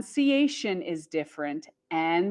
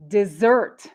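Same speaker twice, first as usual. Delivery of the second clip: desert as dih-ZERT; desert with a quick, reduced first syllable; verb stress on second syllable; 4 dB louder than usual. Shown as a demonstration. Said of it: "we have the verb".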